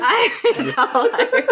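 high, laughing put-on Irish accent; mock Irish accent. Cohost teasing the others' pronunciation, in a mock Irish accent. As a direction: ham up a jokey Irish accent. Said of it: "the accent work on this podcast is going left".

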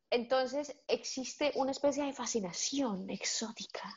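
hushed whisper. Real, not acted. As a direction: whisper it like a secret. Confiding aside in the discussion.

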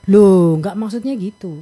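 acted defensive denial. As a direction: jump in protesting, then back-pedal. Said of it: "untuk melihat bahwa dia defensif".

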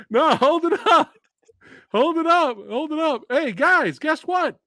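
high-pitched, worked-up interjection; worked-up interruption. Real, not acted. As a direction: cut in high, fighting back laughter. Cutting in while cracking up.

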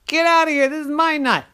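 high, loud shooing squirrel; high annoyed squirrel voice. Acted. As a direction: snap at the pest. Friend shoos the nosy squirrel.

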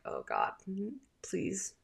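quiet, mildly dreading plea; dreading inner plea. Retold or imitated. Mother silently begging her son to stop asking, quiet dread. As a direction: plead softly, as if only to yourself. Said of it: "my heart just, like, dropped".

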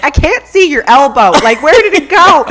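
loud, high comic disbelief; mock outraged exclamation. Retelling being examined in labour, loud mock disbelief. Acting out exclamation during childbirth exam.

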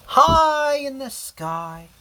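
high, slow and sweeping; soaring storyteller voice. A narrator's reading. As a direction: lift the voice up like flying. Story narration, soaring line.

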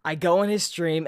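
ordinary talk

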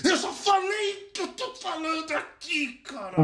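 snapping at the person who keeps interrupting; retold shout. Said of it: "tem uma hora que eu falo assim".

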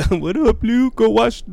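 high-pitched mom impression; Martha. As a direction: play the mom, about to crack up. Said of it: "That's our Martha and Lou impression".

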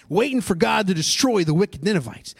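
usual speaking voice

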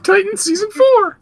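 squeaky, very high cartoon gator; loud falsetto mascot squeak; Gus the Gummy voice. Gus praising a friend, squeaky cartoon voice. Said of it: "'cause Gus told me to".

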